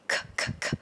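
voiceless puffed "K"; aspirated K. Shown as a demonstration. Teacher isolating the consonant for learners, breathy aspirated "K". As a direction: say "K" as just a burst of air. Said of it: "aspirated K".

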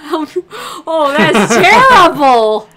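loud laugh on terrible, drawn out; laughing loudly. Real, not acted. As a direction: burst out laughing at it. Laughing at a bad answer.

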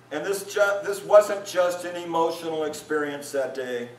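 ordinary talk, nothing marked

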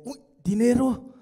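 loud, eager cry of delight; excited gasp at money. Retold or imitated. Mocking the audience's greedy reaction, excited cry. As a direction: exclaim it like money just caught your eye. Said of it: "¿Qué dice dinero?".

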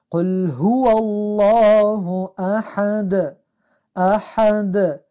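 احد drawn out with ح pressed from mid-throat; slow recitation, pressing ح in احد; chanted احد; throaty ح. Shown as a demonstration. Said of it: "باید از حی از وسط حلق با فشار".

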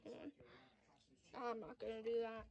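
talking around a phone in mouth; phone held in teeth. Real, not acted. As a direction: speak with a phone between teeth. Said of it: "I have the phone in my mouth".

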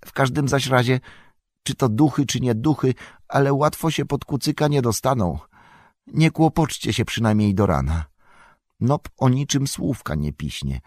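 bustling landlord vowing to stand guard; staunch innkeeper. A narrator's reading. Innkeeper promises to watch all night, resolute.

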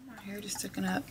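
soft unvoiced whisper. Real, not acted. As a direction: breathe the words, no voice. Whispered aside at start.

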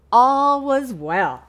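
loud and slow; slow, emphatic opening line; storybook emphasis. A narrator's reading. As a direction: stretch it out for the children.

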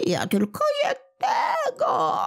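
very high, tearful whine of a tiny creature; a sore little imp wailing for pity; whimpering little goblin. Acted. Little kłobuk crying to old Smętek, very high and tearful.

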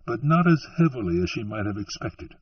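usual speaking voice

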